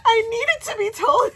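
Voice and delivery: very high, loud retold outburst; emphatic protest. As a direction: imitate a friend crying out through laughter. Continues friend's emphatic reply.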